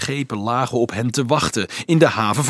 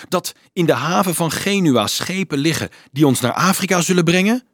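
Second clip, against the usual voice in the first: raised, louder, appalled questioning; indignant and urgent; shocked indignation; 6 semitones higher, 5 dB louder than usual. A narrator's reading. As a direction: confront him, pitch climbing in disbelief. Dolf confronts monk about slave plot.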